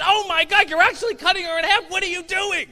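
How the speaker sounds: high, loud horrified shout; horrified yell. Retold or imitated. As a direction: scream it at the magician in horror. Horrified audience yelling.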